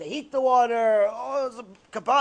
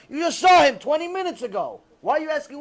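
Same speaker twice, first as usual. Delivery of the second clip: ordinary talk, nothing marked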